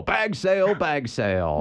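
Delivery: booming announcer chant, rising high; wrestling promo. Shown as a demonstration. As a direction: hype it like a ring announcer. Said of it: "I kinda wanna do the wrestling voice".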